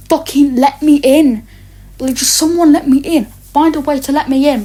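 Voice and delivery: begging angrily to be let in; frustrated demand. Retold or imitated. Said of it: "And I just say".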